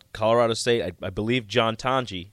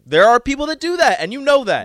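raised voice; 11 semitones higher, 6 dB louder than usual. Real, not acted. Heated on-air squabble between hosts.

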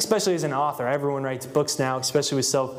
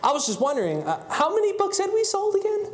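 high, far-off innocent voice, much higher; mock-innocent phone question pitched way up; feigned innocence. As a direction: play dumb sweetly, high up.

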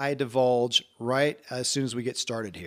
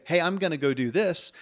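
upbeat, higher-pitched resolve; positive; 4 semitones higher than usual. Shown as a demonstration. Podcast host contrasting chosen action with obligation, positive and upbeat. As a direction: say it like you have chosen it gladly. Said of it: "it just has a positive feel".

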